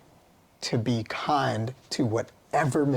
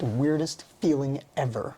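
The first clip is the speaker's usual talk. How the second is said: ordinary delivery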